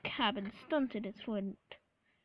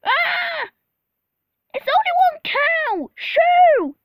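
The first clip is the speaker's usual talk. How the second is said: very high, full-volume yelling; hammed-up high shout; loud shouted hiss; 15 dB louder, slower than usual. A narrator's reading. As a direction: shriek to chase an animal off. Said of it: "he hissed".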